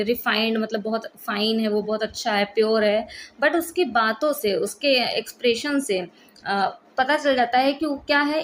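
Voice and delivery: ordinary delivery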